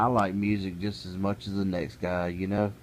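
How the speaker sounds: ordinary talk, nothing marked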